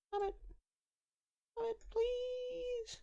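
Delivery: tiny, very high, soft plea; pleading captive. Acted. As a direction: beg faintly in a high little voice. Voices figure behind the glass.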